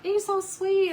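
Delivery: high, loud mock gushing; gushing admirers. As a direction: imitate people fawning over a dog. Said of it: "They're all like".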